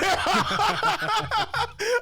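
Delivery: high, loud burst of nervous laughter. Real, not acted. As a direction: laugh it out in a panic. Scared of turning thirty-five.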